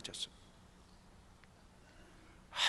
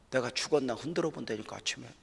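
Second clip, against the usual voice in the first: normal talking voice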